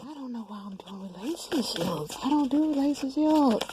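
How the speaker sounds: mock whining about doing relationships; a hammed-up protest; exasperated self-quote. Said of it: "I'm like".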